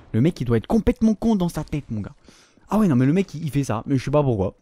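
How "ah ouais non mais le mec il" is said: normal talking voice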